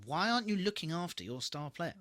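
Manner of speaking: ordinary talk, nothing marked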